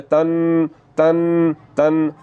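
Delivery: तन with the न held through the nose; teacher demonstrating ghunna; nasal ghunna. Shown as a demonstration. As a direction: hum the न of तन. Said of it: "आवाज़ नाक में जाकर थोड़ा सा लंबा".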